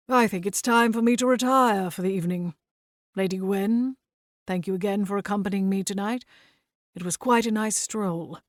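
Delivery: lightly voiced old-fashioned gentleman; a hint of a courtly, proper old man; formal gentleman. Hayward's formal farewell.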